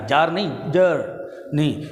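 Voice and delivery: جرنی said slowly twice; slow model of جرنی with the r sounded; slow careful journey. Shown as a demonstration. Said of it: "جرنی کہنا".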